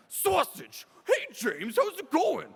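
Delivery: very high, squawky cartoon character voice; [name] doing Stewart. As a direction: shriek it in a zany high character voice. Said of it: "get into character is by saying sausage".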